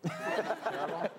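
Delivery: slow, laughing echo of the word; laughing disbelief. Real, not acted. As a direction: repeat it through a laugh. Host laughs at the answer.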